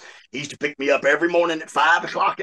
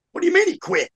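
much higher-pitched mock disbelief; incredulous jail lady; 14 semitones higher, faster than usual. Jail lady hearing an inmate quit, incredulous. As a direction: retell her baffled question in a raised voice.

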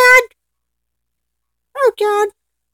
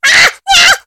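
two short high screams; 6 semitones higher, 3 dB louder than usual. Real, not acted. Player swarmed by zombies on the car, screaming. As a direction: yelp twice in alarm.

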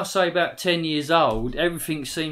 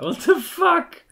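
high, slow, stunned outburst; shocked; 13 semitones higher, slower than usual. Real, not acted. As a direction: blurt it out in disbelief. Shocked by something in game.

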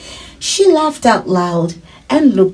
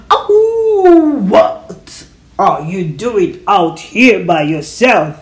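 wolf voice; 3 dB louder, slower than usual. Acted. Wolf questioning a girl alone in the bush, creature voice. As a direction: howl the first word, then slow right down.

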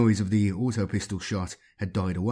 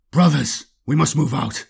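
louder, urgent call to move; breathless call; 4 semitones higher, 6 dB louder than usual. Acted. Chaplain bursting into the ruin, breathless urgent call. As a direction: burst in shouting the alarm. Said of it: "said breathlessly".

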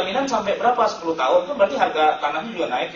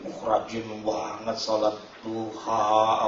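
drawn-out, low, impressed remark; slow imitation of an admiring friend; mock admiration; 6 semitones lower, 3 dB quieter, much slower than usual. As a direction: play an impressed friend, stretching the words slowly. Acted friend admiring one's praying.

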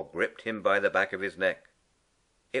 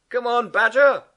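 loud, high call across the bank; shout; 11 dB louder than usual. Acted. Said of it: "shouted Rat".

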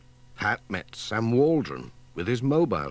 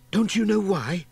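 put-on a touch higher, eager little girl; lightly voiced Katy; 9 semitones higher, 4 dB louder than usual. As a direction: play an excited little girl, only lightly.

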